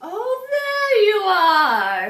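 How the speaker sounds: put-on high, loud, slow old-woman voice; witch voice. A narrator's reading. Old witch greets Arthur at the door, high and slow. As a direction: draw it out like a welcoming old witch.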